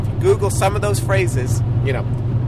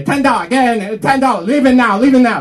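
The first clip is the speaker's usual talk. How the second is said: louder, higher bus hawker call; a hint of a pushy ticket seller; 5 semitones higher, 6 dB louder than usual. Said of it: "You just say, say".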